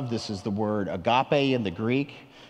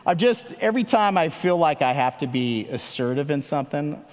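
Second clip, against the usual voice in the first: ordinary talk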